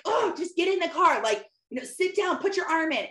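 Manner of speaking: high, loud impatient orders; exasperated yelling. Retold or imitated. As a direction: hurry the kid along, fed up. Mom yelling at child, late.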